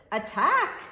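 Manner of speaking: loud, high charging yell; battle cry shout. Acted. Little knight charging from the bushes, shouting.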